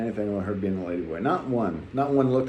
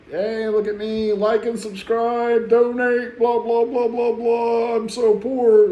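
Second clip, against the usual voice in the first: much higher hammed-up YouTuber plea; mock begging YouTuber; 10 semitones higher, 3 dB louder, slower than usual. Mocking YouTubers who beg, a high hammed-up plea. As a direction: parody a YouTuber begging for donations, high and slowed on the plug. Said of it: "beg for money like all these other YouTubers".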